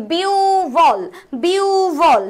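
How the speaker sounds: "বিউভল" with a long biu, then a falling bhol; correct pronunciation. Shown as a demonstration. Teacher gives students the right answer, "বিউভল" drawn out as biuu-bhol. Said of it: "right answer কি হবে".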